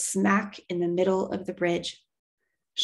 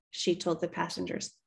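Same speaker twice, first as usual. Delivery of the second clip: ordinary delivery, nothing marked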